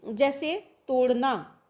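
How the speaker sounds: normal talking voice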